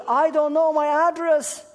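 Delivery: put-on high, loud lost-child voice; a little girl voice, lost and upset; crying little girl.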